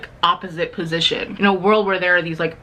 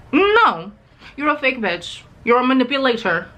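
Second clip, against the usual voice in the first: scornful call-out; 6 semitones higher than usual. Acted. Telling off the Darkling.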